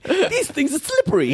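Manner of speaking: high-pitched lisping boxer voice; squeaky, fumbling complaint; Mike Tyson. Retold or imitated. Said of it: "like watching Mike Tyson eat sushi".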